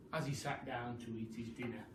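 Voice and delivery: ordinary delivery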